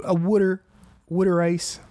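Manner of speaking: mock Philly "Wooder" for water; lightly put-on Philly accent. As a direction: imitate a Philly local, vowel as in "wood". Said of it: "that accent's a little weird".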